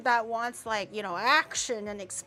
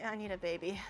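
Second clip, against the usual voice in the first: ordinary talk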